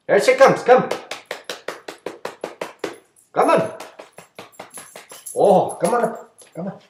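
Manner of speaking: high, bright coaxing call; coaxing the dog. Real, not acted. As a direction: urge the dog up to you.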